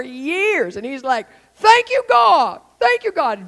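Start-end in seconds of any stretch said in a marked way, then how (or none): 1.5-3.5 high, drawn-out cry of thanks; hammed-up cry to heaven; loud thanksgiving. Long-suffering partner thanking God, high loud cry.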